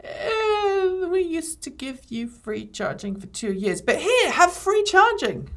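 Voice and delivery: hammed-up sarcastic company voice; mocking Nissan. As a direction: parody a smug company handing out a gift.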